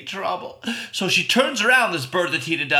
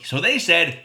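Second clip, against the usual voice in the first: ordinary delivery, nothing marked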